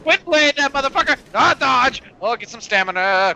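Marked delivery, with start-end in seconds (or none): none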